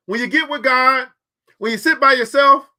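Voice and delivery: ordinary delivery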